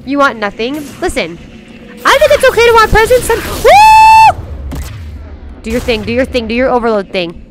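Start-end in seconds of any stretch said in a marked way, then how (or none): 2.1-5.6 high, loud, rising into a whoop; high-pitched loud outburst; excited whoop. Real, not acted. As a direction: exclaim it high, ending in a shriek. Whoops while fighting and chatting.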